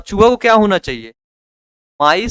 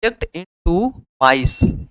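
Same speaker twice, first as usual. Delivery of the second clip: slowly dictated; much slower than usual. Shown as a demonstration. Teacher stretches key English phrase.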